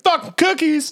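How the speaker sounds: put-on high girl voice. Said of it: "She's like".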